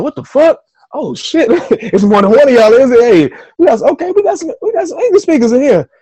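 high, loud, incredulous delight; amazed excitement. Retold or imitated. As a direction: relive the thrilled discovery, laughing, voice shooting way up. Acts out amazement at finding English speakers.

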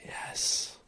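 breathless whispered self-praise; whispered gloat. Real, not acted. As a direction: gloat under your breath. Celebrating a right answer.

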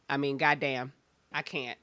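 ordinary talk

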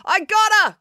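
high, gleeful shout; triumphant, calling out proudly; happy call. Acted. April calls out gleefully after pinning Loretta. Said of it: "called April happily".

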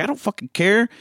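high, mock-amazed voice; hammed-up high fanboy gush; mock incredulous quote. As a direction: pitch it up, playing the fanboy. Mock dialogue, arguing about the movie.

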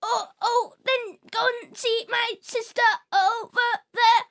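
put-on high, shaky old robot. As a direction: creak it out like an ancient robot. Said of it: "old, old robot".

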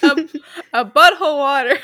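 loud, silly attempt at a British accent; badly faked British accent. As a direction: ham up a clumsy British accent. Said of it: "I suck at accents".

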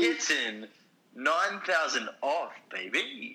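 high, drawn-out victory crow; gloating triumph. Real, not acted. Friend only nine thousand off, gloating high and slow. Gloating over close population guess.

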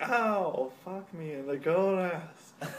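high, slow, laughing pain cry; laughing mock ow. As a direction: whine it while cracking up. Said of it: "He's like".

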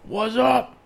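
high, loud yell across a parking lot; retold shouted challenge. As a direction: call someone out, hyped up. Re-enacts challenge, hands up.

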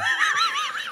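high shrieking burst of laughter; loud real laugh. Real, not acted. Friend cracks up at a dramatic pause, shrieking laugh. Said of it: "The dramatic pause".

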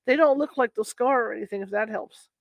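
ordinary delivery

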